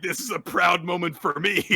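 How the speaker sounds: proud declaration. Retold or imitated. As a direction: announce it with mock pride. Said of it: "she's like".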